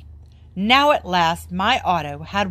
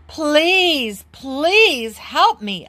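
high, slow begging; pleading; 7 semitones higher, 3 dB louder, much slower than usual. Acted. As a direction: beg for help, desperate. Boy begs Mister Carp on phone.

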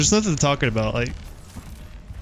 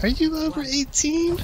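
high-pitched mock woman voice; playful falsetto as a fake woman; 12 semitones higher than usual. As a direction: put on a high, girlish voice. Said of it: "woman voice".

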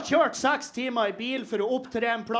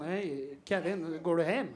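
organizer; 5 semitones lower, 5 dB quieter than usual. Retold or imitated. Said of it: "og han var sånn".

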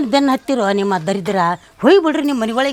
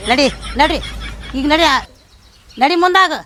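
shooing shout; 3 semitones higher than usual. Acted. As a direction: drive them out the door. Mother chases neighbours out.

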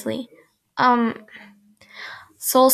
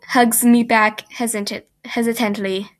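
ordinary delivery

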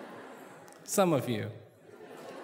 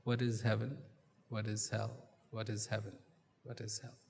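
musing to himself, fading; 6 semitones lower, 10 dB quieter than usual. A narrator's reading. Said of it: "keeps asking himself".

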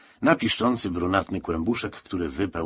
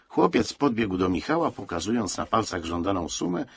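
ordinary delivery, nothing marked

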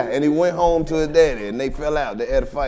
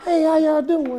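much higher, slow, sing-song hello; mock sweet greeting; 10 semitones higher, 3 dB quieter than usual. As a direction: greet the room like an overly polite sober man.

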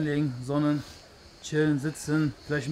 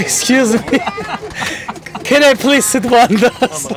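tourist voice; 4 semitones higher, 3 dB louder, slower than usual. Acted. Plays a foreign plane passenger.

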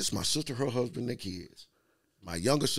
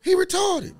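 high, indignant why not; high-pitched exasperated comeback; high incredulous retort; 5 dB louder, slower than usual. Retold or imitated. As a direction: squeak it out in mock disbelief. Retorting in a retold argument.

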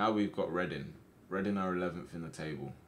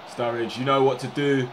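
louder rising urging; excited shout; 6 semitones higher, 13 dB louder than usual. Real, not acted. Streamer urging his striker on, excited shout. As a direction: cheer him through on goal. Cheering on attack.

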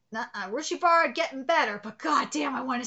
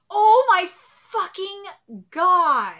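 higher, loud, broken-up oh my god; overwhelmed shout; 4 semitones higher, 9 dB louder than usual. Real, not acted. Streamer losing it over the fan art, loud shout. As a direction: yell it in pieces, losing it.

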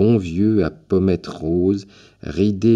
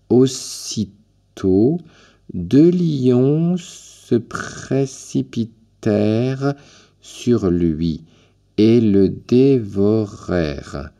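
slow, higher, solemn schoolchild voice; child reading gravely; 5 semitones higher, 3 dB louder, much slower than usual. A narrator's reading. Orphan girl reading on while the old man sleeps, slow and grave. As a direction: sound out each word like a serious little pupil. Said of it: "l'enfant continuait sa lecture d'un air grave".

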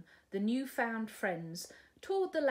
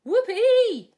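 whoopee cried out very high and loud; cry of joy; 13 semitones higher, 18 dB louder than usual. Acted. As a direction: let out a thrilled whoop. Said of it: "cried Sue".

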